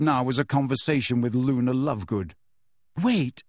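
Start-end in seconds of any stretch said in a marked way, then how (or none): 3.0-3.4 higher, abrupt interjection; quick, urgent halt; sudden. A narrator's reading. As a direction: cut in suddenly with an idea. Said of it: "said Hermione suddenly".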